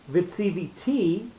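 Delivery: Vitsiviti with the final TEE stressed and drawn out; last-syllable stress. Shown as a demonstration. Teacher posing the future-tense reading, Vitsiviti stressed on final TEE. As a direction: land on the end: vit-si-vi-TEE. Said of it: "with the accent on the last syllable".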